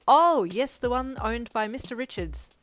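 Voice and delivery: bright loud burst of recognition; happy. A narrator's reading. Estate agent recognising the listing, happily. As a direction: perk up helpfully. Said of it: "he replied happily".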